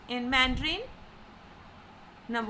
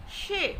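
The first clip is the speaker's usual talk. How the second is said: "she" high, falling sharply; fourth tone; 4 semitones higher than usual. Shown as a demonstration. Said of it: "with four tone".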